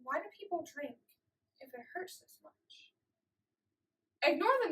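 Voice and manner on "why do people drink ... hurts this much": very soft, fading almost to nothing; pained quiet question. Acted. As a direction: ask it small and hushed, as if to yourself. Pained question of a first-time drinker.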